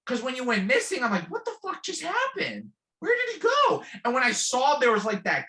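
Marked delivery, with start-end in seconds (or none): 1.3-3.8 much higher, panicked yelp of alarm; panicked freak-out. Retold or imitated. Friend vanishes off the dune, panicked high freak-out. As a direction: shriek as if your friend just vanished. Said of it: "I freaked out on camera".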